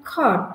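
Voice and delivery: "খট" with a puff of air after K; aspirated K. Shown as a demonstration. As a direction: open "খট" on breathy kh. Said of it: "উচ্চারণ হবে". ("খট"-এর খ মহাপ্রাণ উচ্চারণে)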